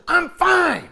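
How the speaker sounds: high, loud, snapping back; boy snapping at his mother after a play; annoyed boy. Retold or imitated. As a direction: bite it off, irritated. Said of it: "he says".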